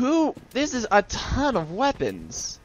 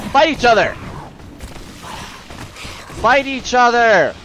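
goading shout; 6 semitones higher than usual. Real, not acted. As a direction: egg them on to brawl. Urging enemies to fight each other.

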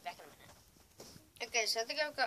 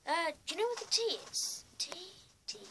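higher-pitched put-on English accent; hammed-up English accent; Englishman; 8 semitones higher than usual.

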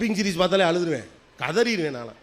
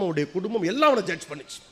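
ordinary talk, nothing marked

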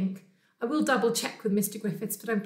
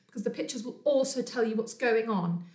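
plain reading voice

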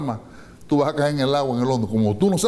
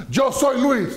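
bold false claim; 10 semitones higher, much slower than usual. Acted. Man falsely claims to be Luis.

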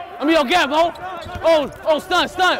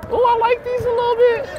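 excited; 3 dB louder than usual. Real, not acted. High delighted exclamation.

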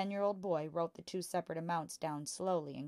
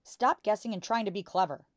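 scolding; 4 semitones higher, 9 dB louder than usual. A narrator's reading. Father scolds Matilda.

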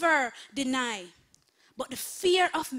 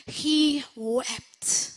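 He wept said louder, with sorrow; sorrowful emphasis; 2 semitones higher, 6 dB louder than usual. Shown as a demonstration. Pastor dwelling on Peter's grief, sorrowful emphasis. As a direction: let the grief sit on "He wept".

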